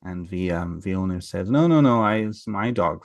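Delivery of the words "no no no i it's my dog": insistent denial. Retold or imitated. Farmer to little Welsh man claiming the dog, insistent denial. Owner denies having the stranger's dog.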